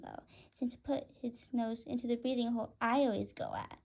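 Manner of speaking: ordinary delivery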